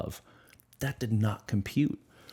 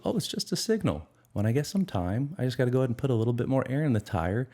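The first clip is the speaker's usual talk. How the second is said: much higher, quick breezy unbothered tone; calm shrug; 5 semitones higher than usual. Retold or imitated. Driver calmly shrugs off the tire light, breezy and high. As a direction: brush it off like nothing's wrong. Calm response to dashboard signal.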